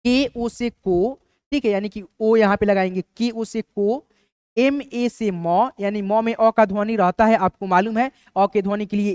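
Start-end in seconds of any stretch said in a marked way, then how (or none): 0.0-1.2 slow, deliberate letter by letter; slow spelling out. Shown as a demonstration. Teacher spelling slowly for students learning transliteration. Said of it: "यहाँ पे कैसे लिखेंगे इसका English". 3.2-5.7 very slow, each letter drawn out; slow spelling out. Shown as a demonstration. Teacher dictating slowly to students writing names. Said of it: "यहाँ पे कैसे लिखेंगे इसका इंग्लिश".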